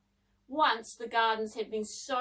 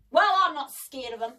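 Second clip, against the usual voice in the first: loud, fierce bravado; forceful show of courage; 3 semitones higher, 8 dB louder than usual. A narrator's reading. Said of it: "said David fiercely".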